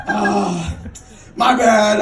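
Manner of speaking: ordinary talk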